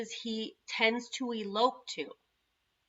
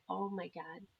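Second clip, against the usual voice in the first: dismayed hush; 2 semitones lower, 11 dB quieter than usual. Retold or imitated. Mom hearing son is missing.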